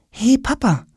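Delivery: put-on high-pitched boy's greeting. A narrator's reading. Tom sits in bed greeting father, high boyish voice.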